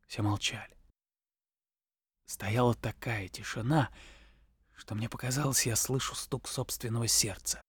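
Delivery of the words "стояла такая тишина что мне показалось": ordinary delivery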